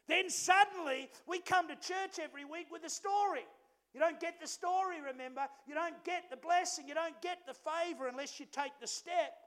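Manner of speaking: loud, high, ringing preacher push; preaching at full force. Acted. Pastor urging the congregation, preaching at full force. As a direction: drive the point home to the church.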